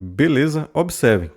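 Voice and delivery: ordinary talk, nothing marked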